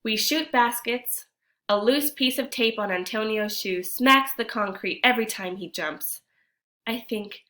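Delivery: storytime reading voice, a touch lively; story narration. A narrator's reading. Teacher reads the picture book to kids, lively narration. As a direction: read the tale to the class.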